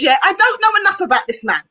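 normal talking voice

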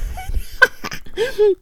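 a burst of high-pitched giggling; high squealing laugh. Real, not acted. Host laughing at her own odd wording, high squeal.

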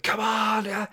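high rousing "come on"; rally the crowd, high and bright; excited cheer. Retold or imitated. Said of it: "everyone's like".

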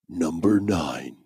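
low, slow countdown announcer; deep announcer voice. Pretend. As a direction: boom out the number like a game show. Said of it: "the super deep voice".

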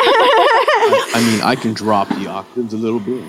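chuckling aside; amused, talking through laughter; host joking along, laughing. Real, not acted. Host joking about voice pitch.

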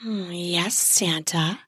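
soft, aroused murmur; aroused mutter. Acted. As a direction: breathe it out under the arousal. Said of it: "I muttered".

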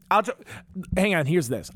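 usual speaking voice

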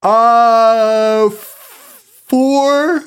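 words stretched through a big real yawn. Real, not acted. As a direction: say it mid-yawn, high and drawn out. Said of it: "Sorry, I'm yawning".

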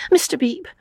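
high, pleading, distressed voice; small high plea; tearful appeal. A narrator's reading. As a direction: ask for help through tears. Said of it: "looked at the sobbing girl".

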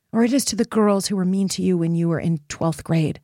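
ordinary talk

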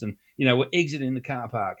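normal talking voice

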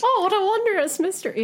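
high-pitched cartoon impression; high mock-wonder exclamation; Martin Prince. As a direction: put on a high, mocking cartoon voice. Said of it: "Martin Prince on The Simpsons".

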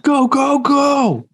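high, urgent action-movie yelling; Brendan Fraser yell. Retold or imitated. Backing up the yeller review, high mock-heroic yell. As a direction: yell each word like an action hero. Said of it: "The man can yell".

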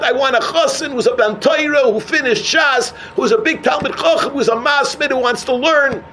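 high-pitched, insistent accusing plea; retold aggrieved complaint. Unmarried girl to the speaker she blames, retold aggrieved complaint. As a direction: pile up her grievance, pitched high. Girl's long complaint, blaming him.

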